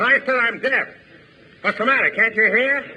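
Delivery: high mock shout. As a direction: shout it back, hammed up. Shouts back.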